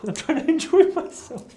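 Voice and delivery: high squeal, laughing; laughing plea to enjoy games; laughing protest. Real, not acted. Laughing defence of going to games.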